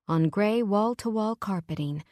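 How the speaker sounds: usual speaking voice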